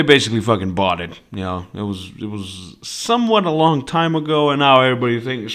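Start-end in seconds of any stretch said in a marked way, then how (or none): none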